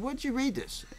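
baffled, demanding to know; astonished question. Retold or imitated. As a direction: pitch shoots way up in disbelief. Stranger claims he's a millionaire.

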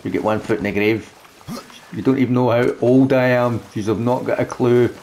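ordinary talk, nothing marked

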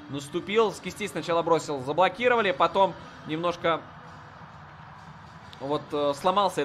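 ordinary talk, nothing marked

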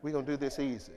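low, laughing [name] bit. Retold or imitated. Preacher riffing on the song intro, low and laughing. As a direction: tease it out, low and playful. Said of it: "[name]".

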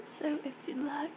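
whispered, hushed and careful; wildlife watching whisper. Real, not acted. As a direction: whisper so the animal stays calm. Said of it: "I'm being really quiet".

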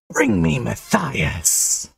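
cold, slow ghostly demand; possessed by a ghost, drawn out; Willow. A narrator's reading. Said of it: "He coldly demanded, in Willow's voice".

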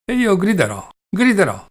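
high, loud playful threat to shout; cheerful mock yell. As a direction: shout it as if it's a game. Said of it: "con lo stesso ridere allegro".

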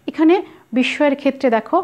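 ordinary talk

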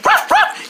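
high pretend woof; playful dog bark. Voicing the dog who is glad it's over, high playful barks. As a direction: yap like a little dog.

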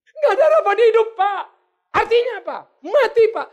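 high, loud wail of a sick churchgoer; despairing sick person. Acted. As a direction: cry out like someone with no hope. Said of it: "Kata dokter, Pak, saya nggak ada harapan". (jemaat sakit meratap keras dan tinggi)

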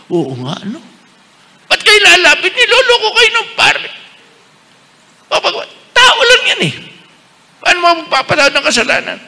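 high, loud mocking voice of a scoffer; shrill it up like a jeering bystander; mocking gossip. Bishop parodying people who say priests fool them, jeering. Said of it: "Nagugulat yung mga iba eh".

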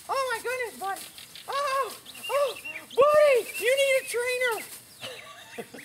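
put-on high baby-talk fussing over the horse; mock fussing. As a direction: coo at him like a doting owner.

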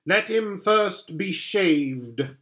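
slow, deliberate witch command; cold witch order. Acted. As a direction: lay out the order slowly as the witch. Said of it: "said the witch".

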